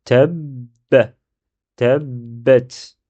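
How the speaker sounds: "Tab" halted on the b, "tabbat" held double; stopped b, then doubled. Shown as a demonstration. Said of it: "C'est comme si j'ai un ba avec soukoun".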